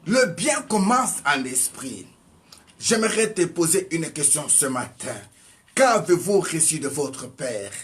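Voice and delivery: ordinary talk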